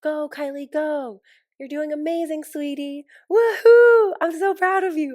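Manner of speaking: high, loud, gushing cheer with a squeal; shrieking proud-mom cheerleading; Kris Jenner cheering. Retold or imitated. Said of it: "She's like".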